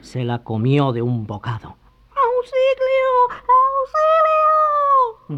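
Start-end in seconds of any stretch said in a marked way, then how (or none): 2.1-5.4 very high, slow, drawn-out cry for help; little voice crying out. Acted. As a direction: call for help from far inside. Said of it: "gritaba todavía una vocecita". (gritos agudos de auxilio)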